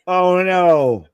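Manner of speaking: high, loud drawn-out cry of dismay; loud dismayed reaction. Real, not acted. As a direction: wail it out at the match. Reacting to the match on screen.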